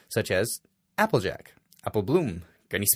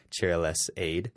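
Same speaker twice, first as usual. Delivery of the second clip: usual speaking voice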